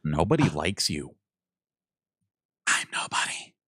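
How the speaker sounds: whispering. Acted. Voicing characters, then whisper.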